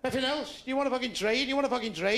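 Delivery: high-pitched caricature of a takeaway server; mock chip-shop server. Chip shop worker pressing a customer, high caricatured voice. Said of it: "I go in their chip shops".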